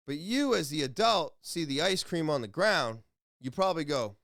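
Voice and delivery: ordinary delivery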